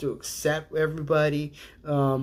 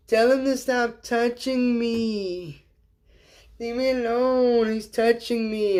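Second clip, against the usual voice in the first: high, slow whine to a parent; whiny tattling kid; 8 semitones higher, slower than usual. Retold or imitated. Kid complaining his brother touches him, high whine. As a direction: wail it like a child tattling. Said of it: "the Democrats like the kid going".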